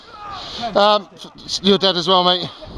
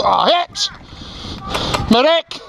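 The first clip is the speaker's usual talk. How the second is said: sudden pained shout for a medic; man down shout; 5 semitones higher, 4 dB quieter, slower than usual. Real, not acted. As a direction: yell out after being hit. Player shot, calls for medic.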